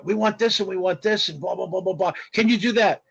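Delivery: imitated French-accented caller; French accent, as if reeling off orders. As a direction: rattle off demands in a mock French accent. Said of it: "strong French accent".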